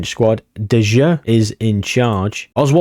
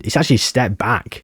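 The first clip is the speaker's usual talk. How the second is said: normal talking voice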